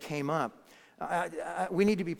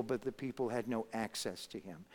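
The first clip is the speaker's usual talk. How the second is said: ordinary delivery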